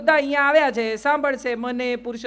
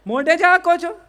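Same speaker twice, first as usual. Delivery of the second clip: loud, higher mock scolding of the crowd; jokey rebuke; teasing reproach; 5 semitones higher, 6 dB louder than usual. Real, not acted. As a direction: call them out playfully. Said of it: "chiding audience".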